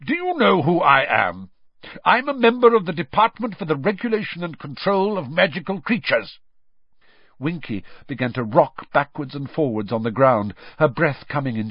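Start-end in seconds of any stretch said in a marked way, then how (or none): 0.0-6.4 raised, authoritative interrogation; stern. A narrator's reading. Official announcing his rank to the elf, stern. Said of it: "said Mister Diggory sternly".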